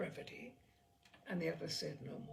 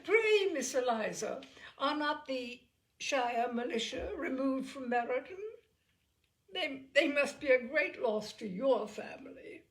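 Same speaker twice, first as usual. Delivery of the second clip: raised, louder mock-polite sneer; sneering civility; 5 semitones higher, 7 dB louder than usual. Miss Bingley needling Elizabeth about the militia, sneering civility. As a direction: sneer through sweet politeness. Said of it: "saying with sneering civility".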